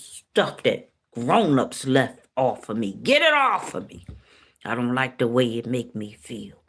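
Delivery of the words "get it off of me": high-pitched plea bursting out mid-story; pleading. Retold or imitated. Woman begging her father to take it off, pleading. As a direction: beg to be rid of it, pitch leaping. Retold desperate plea to God.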